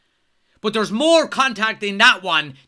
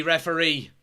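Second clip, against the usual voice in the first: normal talking voice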